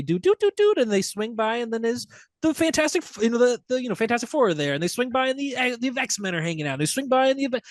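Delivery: high, bouncy humming through the scene; excited sing-song. Retold or imitated. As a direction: hum it like a sweeping movie shot. Humming a tracking-shot score.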